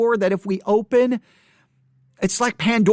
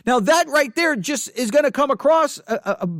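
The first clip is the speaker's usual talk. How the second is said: ordinary talk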